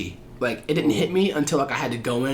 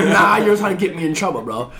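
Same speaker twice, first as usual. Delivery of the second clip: laughing mock; 5 semitones higher, 6 dB louder than usual. Mocking crying recruit.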